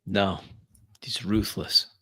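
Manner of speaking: usual speaking voice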